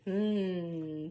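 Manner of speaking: ordinary delivery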